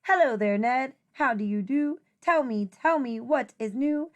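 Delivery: normal talking voice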